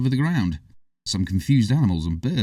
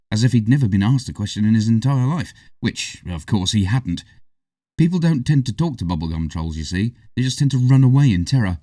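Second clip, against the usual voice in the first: plain reading voice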